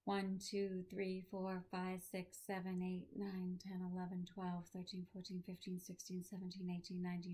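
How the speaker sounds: soft, steady count sinking to a creaky, airless whisper; one-breath count. Shown as a demonstration. Teacher demonstrating the breath test to students, count running out of breath. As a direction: keep counting until the breath is all gone. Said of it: "do the breath test".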